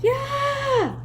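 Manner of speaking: a sudden scream out of nowhere. A narrator's reading. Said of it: "Leslie screamed".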